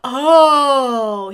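loud, drawn-out oh; light bulb moment; sudden realization at the gym plan; dawning realization. Retold or imitated.

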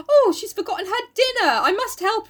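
high-pitched, put-on alarm; concerned gentleman. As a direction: fuss over her in a high mock-helpful voice. Friend's concern, acted in a retold story.

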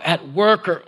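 usual speaking voice

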